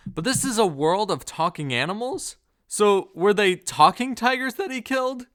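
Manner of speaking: baffled question that climbs high and loud; incredulous question. Real, not acted. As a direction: ask it in sheer disbelief. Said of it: "I have so many questions".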